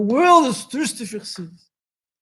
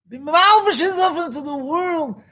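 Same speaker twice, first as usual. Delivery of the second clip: preaching exhortation; 6 semitones higher than usual. Real, not acted. Rising sermon exhortation.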